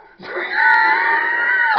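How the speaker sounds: very high, loud squeal of laughter; piercing cackle; loud shrieking laugh. Real, not acted. Laughing through fight story.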